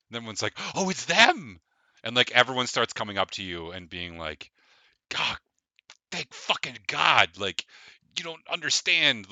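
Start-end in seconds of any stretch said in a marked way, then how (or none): 0.7-1.6 loud, high burst of delight; happy shout; excited recognition. Acted. Crowd recognizing the heroes. 5.1-9.3 relieved gushing. Acted. Grateful townsfolk gushing.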